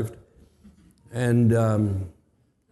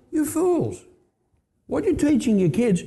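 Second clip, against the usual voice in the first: much higher, exasperated outburst; exasperated inner cry; 10 semitones higher than usual. Retold or imitated. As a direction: cry out at foolish parents in your head. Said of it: "But I think".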